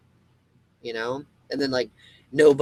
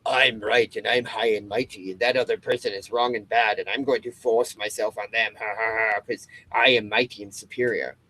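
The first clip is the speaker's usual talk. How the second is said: lightly voiced smug bigot with a fake laugh; hint of a gloating tyrant; mock self-righteous voice. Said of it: "clouding your brain with".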